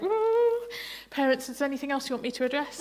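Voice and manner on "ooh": clouded-over ooh. Retold or imitated. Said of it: "it's like".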